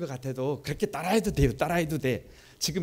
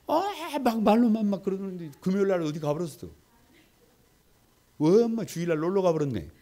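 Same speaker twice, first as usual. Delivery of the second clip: higher, mocking tone at no-shows; 7 semitones higher, 4 dB louder than usual. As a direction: tease the absent members. Mocking absent churchgoers.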